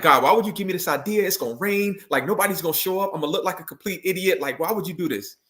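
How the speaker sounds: imitating his anxious, fed-up self complaining to God; exasperated complaint to God. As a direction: act out griping at God that it'll rain. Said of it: "we get upset with God".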